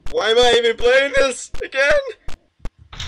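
high, loud, slow wail of dread; drawn-out loud lament; distressed wail. Real, not acted. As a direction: wail it out in scared exasperation. Frightened player regrets playing.

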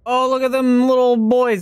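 high cooing over cute things. Real, not acted. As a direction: gush like seeing puppies.

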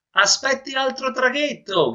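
high sailor's call. Acted. Sailor calls to the man in the water, high shout. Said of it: "gridò un marinaio".

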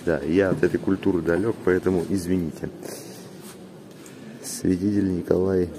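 ordinary delivery

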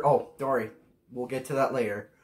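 usual speaking voice

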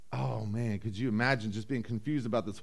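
usual speaking voice

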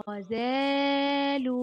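"غزال" with a long, held aa; "غزال" drawn out very slowly on the alif; lengthened fatha. Shown as a demonstration. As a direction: stretch the middle of "غزال": ghazaaaalu. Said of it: "بيطيل صوت الفتحة قليلًا".

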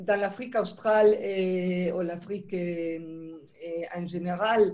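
non-native French accent; French spoken with a foreign accent. Real, not acted. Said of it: "mon français peut-être c'est un peu rougi". (accent étranger en français)

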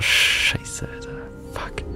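scared horror game streamer. Real, not acted. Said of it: "ich hab echt grad wirklich Schiss bekommen".

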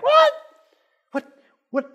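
very high, loud yelp of disbelief; mock shocked squawk. Wife hearing of Tommy's adultery, hammed-up shocked squawk. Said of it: "he's freaking out".

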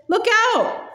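sudden high warning cry; calling out a warning. A narrator's reading. The crowd warning the policeman of the horse's kick, high urgent cry. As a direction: yell a quick warning to the policeman. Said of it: "called several voices".

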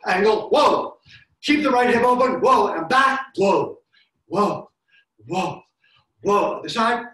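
loud, high coaching calls; shouting cues. Real, not acted. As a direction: call each cue out loud to the class. Coach calling cues during the swing exercise.

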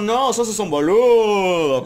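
high, loud, slow Buenos Aires teasing; hammed-up Argentine insult, drawn out; mock porteño accent. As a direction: drag it out like a joking Argentine friend. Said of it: "el acento porteño".

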